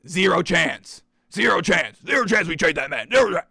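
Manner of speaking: building from firm to a shout; mock general manager, growing to a yell; emphatic quote. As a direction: hammer it harder each time. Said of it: "Keem's reply was short and emphatic".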